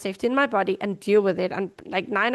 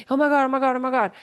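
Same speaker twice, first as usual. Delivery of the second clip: mock panic; 3 semitones higher, faster than usual. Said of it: "when you start getting the".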